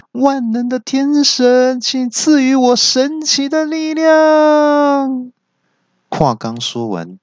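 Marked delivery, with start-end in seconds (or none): 0.1-5.3 高声慢念的祈神呼喊; 像召唤天神一样高举着喊. A narrator's reading. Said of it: "大喊". (高亢缓慢地呼唤天神)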